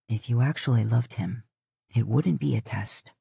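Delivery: low teasing man's voice; low, needling tease. Acted. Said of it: "he teases".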